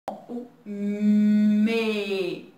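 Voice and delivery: "me" said alone, loud; isolated syllable. Shown as a demonstration. Teacher to pupils, sounding out me loudly. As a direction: sound out "me" by itself. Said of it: "Olha o primeiro som".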